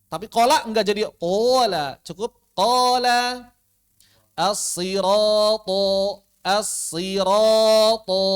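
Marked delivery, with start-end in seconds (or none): 4.3-8.2 As-siratu slowly drawn with a thick sod and ro; heavy recitation. Shown as a demonstration. Teacher recites a Quran word slowly for students. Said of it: "Jangan dibalik".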